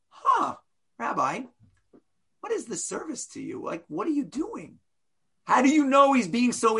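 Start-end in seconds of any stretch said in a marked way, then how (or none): 0.1-4.8 soft, genuinely puzzled asking; sincere curious question. Shown as a demonstration. Teacher demonstrates the question read as honest curiosity. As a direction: ask gently, really wanting to know.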